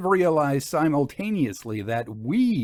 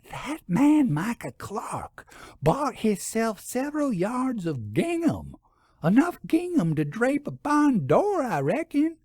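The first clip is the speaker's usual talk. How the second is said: gossipy shopkeeper voice; 11 semitones higher than usual. A narrator's reading. Shopkeeper confiding scandal to visitors, high gossipy voice. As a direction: lean in and share the scandal. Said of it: "lowered her voice even more".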